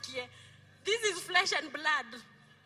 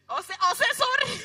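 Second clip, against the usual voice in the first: higher and louder teasing jab; playful emphasis; 9 semitones higher, 7 dB louder than usual. Real, not acted. Pastor teasing husbands to apologize, playful and loud. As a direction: tease the men with a raised voice.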